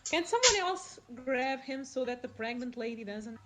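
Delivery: ordinary talk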